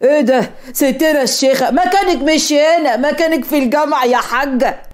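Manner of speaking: jeering street heckler's voice; mocking crowd. Bystanders ridiculing a veiled girl on a scooter, jeering. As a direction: taunt her with drawn-out ridicule. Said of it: "وفضلوا يضحكوا".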